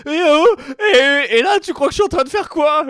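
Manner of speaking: high, loud excited calling out; excited shouting. Retold or imitated. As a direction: yell the question across the kitchen. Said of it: "J'étais là, ma cuisine, genre".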